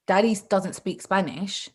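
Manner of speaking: normal talking voice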